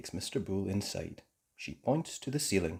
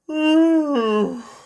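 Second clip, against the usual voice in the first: loud, high sleepy yawn; big yawn; 11 dB louder than usual. Acted. Daddy Pig woken early, yawning. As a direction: yawn widely. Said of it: "yawned Daddy Pig".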